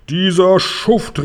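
erregt, gedehnt, höher ausgerufen; empörter Ausruf. A narrator's reading. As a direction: schimpf los, langsam und gedehnt. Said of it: "rief er". (wütend ausgerufen)

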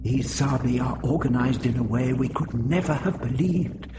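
muttered. A narrator's reading. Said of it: "he muttered".

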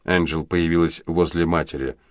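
ordinary delivery

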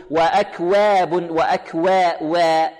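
وأكواب with wa fronted towards weh; slow وأكواب with an overly fronted a; over-thinned waw, wrong way. Shown as a demonstration. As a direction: mimic exaggerated thinning of وا. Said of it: "والبعض يبالغ في الترقيق".

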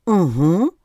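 mumbled through a mouthful; talking with her mouth full. Acted. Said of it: "пробормотала она с набитым ртом".

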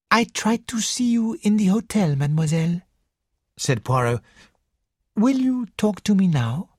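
lightly voiced polite detective; a hint of Belgian detective politeness; Poirot voice.

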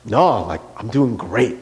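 low, insistent denial; doubling down. Retold or imitated. Husband doubling down to his wife, low and insistent. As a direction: dig in, keeping it low and firm. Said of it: "I would just double down".

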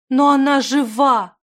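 high, loud, drawn-out protest; insistent. A narrator's reading. Zimana refuses to give up on Stekhana, insistent. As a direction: insist desperately, slow and loud. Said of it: "настаивала Зимана".

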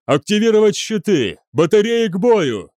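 high, urgent yell; shouted battle orders. A narrator's reading. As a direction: rouse the crew to battle. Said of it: "закричал Ван Аунгер". (выкрикивать боевые команды)

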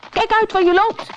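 very high warning cry; shouting. Acted. Stoat cries out to the galloping ponies, a high warning yell. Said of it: "schreeuwde Barney".

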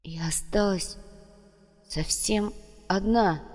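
soft, slow, halting despair; hushed, drawn-out loneliness; desolate realization. A narrator's reading. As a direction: let it sink in quietly, with pauses. Same realization, sinking in quietly.

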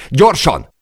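high, loud urgent cry; shouted order. A narrator's reading. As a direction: yell it to hurry him along. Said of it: "kiáltotta Per". (sürgetően elkiáltva)

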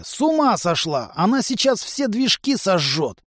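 high, agitated protest; worked-up disbelief in a high voice; alarmed exclamation. A narrator's reading. Alarmed at the reckless pilot.

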